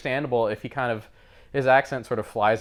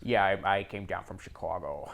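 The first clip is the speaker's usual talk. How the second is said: mock Chicago accent; 4 semitones lower, 7 dB quieter than usual. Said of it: "his weird Chicago accent".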